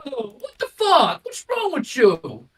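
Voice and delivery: high, indignant mock-Italian protest; hammed-up Italian-American complaint. Freight cars as protesting Italians, hammed-up accent. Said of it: "Imagine the freight cars were Italians".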